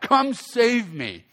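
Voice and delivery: slow, drawn-out "Come save me."; preacher relishing the plea, slow and dreamy; savoring the plea. Retold or imitated. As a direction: linger on "Come save me." like a sweet invitation. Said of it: "what guy doesn't wanna hear that?".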